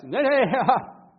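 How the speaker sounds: a touch of a nervous laugh, brushing it off; laughing deflection. Retold or imitated. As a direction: wave the blame away with a chuckle. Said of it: "Und gleich mit dem Hinweis".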